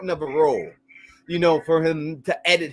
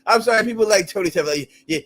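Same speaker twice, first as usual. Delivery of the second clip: ordinary talk, nothing marked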